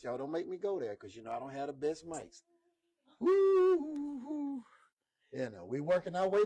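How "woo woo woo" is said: whoop. Real, not acted. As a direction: let out a long woo that fades. Preacher whoops mid-sermon.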